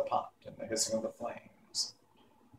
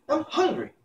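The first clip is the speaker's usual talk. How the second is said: put-on sulky boy. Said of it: "said Tip in a sulky tone".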